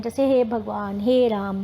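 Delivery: ordinary talk, nothing marked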